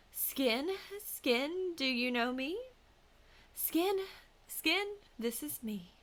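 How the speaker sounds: high, loud calling out skin, skin, rising each time; hag calling her skin. A narrator's reading. Hag wife calling to her skin from the stairs, loud eerie calling. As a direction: call sweetly to the hidden skin like a creature.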